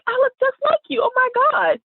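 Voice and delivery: high-pitched retold squeal of recognition; thrilled high exclamation; excited amazement. Retelling amazement at the resemblance.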